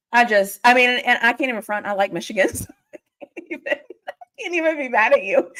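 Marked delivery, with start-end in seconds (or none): 2.5-5.5 laughing through the words. Real, not acted. Laughing as she admits to a Michigan fan she can't be mad. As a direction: giggle while admitting it.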